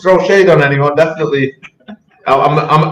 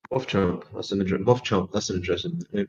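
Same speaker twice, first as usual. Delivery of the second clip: low, quiet aside to oneself; softly pondering, pitched well below normal talk; musing aloud; 6 semitones lower, 13 dB quieter than usual. Real, not acted. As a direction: drop it right down, as if reading a name to yourself. Musing on a name to himself.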